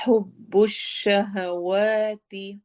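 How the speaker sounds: हुब्बुश शहवाती slow, lips rounded, vowels long; slow Quranic recitation. Shown as a demonstration. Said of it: "होंठ गोल होंगे और लंबा होगा".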